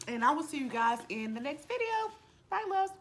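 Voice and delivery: cheery sign-off. Real, not acted.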